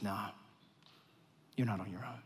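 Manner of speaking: soft, tender divine reassurance; gentle voice of God. Acted. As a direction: speak as God quietly comforting a worried child. God answers the worried believer.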